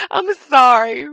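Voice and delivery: high, loud apology through laughter; real laugh bubbling into the words. Real, not acted. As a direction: say sorry while still cracking up. Apologising after laughing fit.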